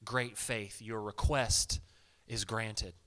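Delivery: slow, low and gentle; a slow, gentle, solemn pronouncement; solemn blessing. Retold or imitated. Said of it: "it says very specifically".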